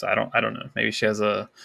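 usual speaking voice

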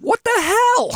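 high-pitched loud shocked yell; shriek. Retold or imitated. Flight attendant shrieking as a passenger seizes up, shocked shriek. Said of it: "I shrieked, and I said".

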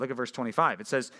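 usual speaking voice

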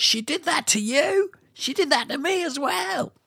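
high-pitched mock Australian; Australian accent. Gossiping about what she did, high Australian accent. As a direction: push it up high into a put-on Australian twang. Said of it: "abused for my Australian accent".

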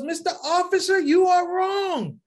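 put-on high, drawn-out child's protest; high-pitched, emphatic correction of an officer; daughter interrupting. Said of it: "My daughter, alhamdulillah, jumps in the middle of the argument".

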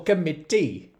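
Welsh accent. Shown as a demonstration. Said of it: "to say in a Welsh accent".

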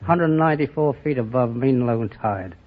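normal talking voice

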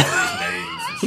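squeaky laughing yelp; high laughing shout. Real, not acted. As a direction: blurt it out laughing. Laughing in-character outburst.

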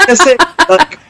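high, laughing disbelief; laughing exasperation. Real, not acted. Exasperated laughing about skin tone.

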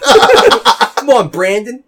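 high mock southern holler; hammed-up southern come-on; southern accent. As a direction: beckon in a put-on southern accent. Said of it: "they all have a southern accent".

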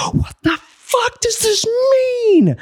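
reenacting a scream at the universe; primal yell. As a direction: scream it at the sky. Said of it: "Just yelling out".

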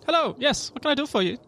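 high, bright-greeting elf shopkeeper; elf woman. Acted. Elf alchemist asks newcomer what she can do, high and cheery. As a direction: welcome a customer in a high accented voice. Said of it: "elf woman come out".

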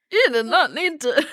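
high, put-on indignant voice; lightly hammed-up indignation; mock offended reply. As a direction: say it like someone mildly insulted. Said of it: "And I'm like".

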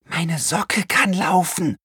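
put-on high, slow girl's voice, hushed; breathless amazement, high and slow; hushed little girl. Girl telling her brother her sock runs, high and hushed. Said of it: "flüsterte sie".

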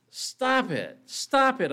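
high-pitched plea, climbing on the repeat; crying out. A narrator's reading. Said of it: "I cried".

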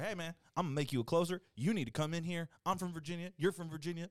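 put-on Virginia accent. New manager recruiting a worker by phone, put-on Virginia accent. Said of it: "Good accent".

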